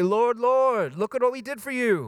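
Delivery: high, urgent acted plea; acted protest, insistent and high; desperate pleading. The rejected pleading at judgment.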